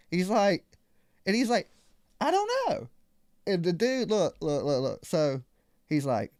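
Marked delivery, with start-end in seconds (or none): 2.2-2.9 1 high innocent manager. Retold or imitated. Manager's answer voiced.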